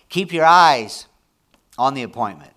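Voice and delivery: sudden loud high call, then easing off; preaching shout. Real, not acted. Preacher to his congregation, loud rising exhortation. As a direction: belt out the exhortation.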